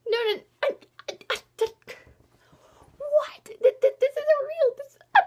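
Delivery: high, loud, stuttering denial; overwhelmed, squeaky stammer of refusal; stammering disbelief. Retold or imitated. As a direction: splutter in shock, words breaking off. Reenacts disbelief at memory loss.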